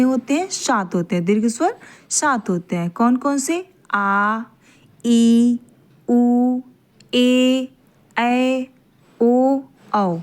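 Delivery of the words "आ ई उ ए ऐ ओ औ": long vowels आ, ई, उ, ए, ऐ, ओ, औ each drawn out; long vowels आ ई उ ए ऐ ओ औ, each one held long; long vowels demo. Shown as a demonstration. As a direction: stretch every vowel longer. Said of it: "आप स्वयं उच्चारण करके देखिए".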